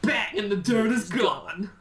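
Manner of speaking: mock advert. Parroting a TV ad.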